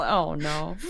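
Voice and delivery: ordinary delivery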